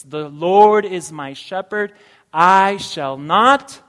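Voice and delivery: booming, slow declaration of the verse; proclaiming loudly. Acted. Pastor proclaiming the psalm to the congregation, booming and slow. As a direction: ring it out to the whole congregation.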